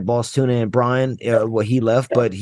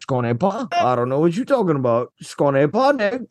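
playing dumb; 4 semitones higher than usual. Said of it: "I'm looking at him just like".

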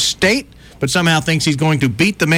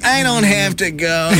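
slow, higher put-on androgynous character; Pat; 4 semitones higher, slower than usual. Host imitating a sketch character at the bathroom, slow silly voice. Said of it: "the Pat character would go up".

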